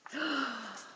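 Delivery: quick sharp indrawn gasp of surprise. Acted. Reader reacting to the plane for children, sharp gasp. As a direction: gasp as if the plane just took off.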